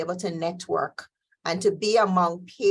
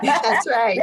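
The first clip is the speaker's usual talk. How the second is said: higher, laughing agreement; 5 semitones higher, 7 dB louder than usual. Real, not acted. Guest laughing back at the host, laughing.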